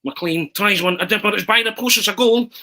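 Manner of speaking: commentary rising high and loud to the goal; Arthur Montford impression. Schoolboys walking in after the match, hammed-up commentator call. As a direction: play the excited TV commentator. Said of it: "that's where the kind of impersonation came from".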